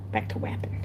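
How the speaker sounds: voiceless, hushed whisper. Real, not acted. Crafter returns to work after screaming, whispering. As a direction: whisper your return to the task. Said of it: "Why are you whispering?".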